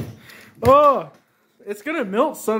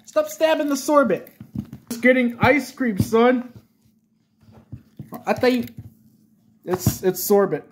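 usual speaking voice

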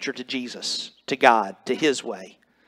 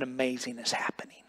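hushed whisper of awe; an awed whisper to the congregation; 5 semitones lower, 12 dB quieter than usual. Real, not acted. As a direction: breathe it out under the voice. Preacher drops to a hush.

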